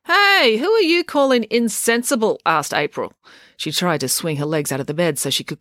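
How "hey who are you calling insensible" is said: much higher, louder indignant yelp; offended girl snapping back; hey shot out high and loud; indignant outburst. A narrator's reading. April indignant at doctor.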